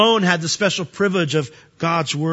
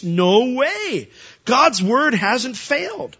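slow, higher firm "No way"; emphatic denial; 6 semitones higher, much slower than usual. Retold or imitated. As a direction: insist slowly that "God's word hasn't failed". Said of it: "Paul emphatically states".

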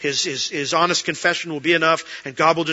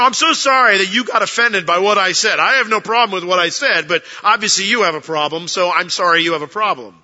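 louder, higher, smug non-apology; mock celebrity apology; 3 semitones higher, 6 dB louder than usual. Celebrity mocking the offended, smug and loud. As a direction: play the celebrity who blames the offended. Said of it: "you know".

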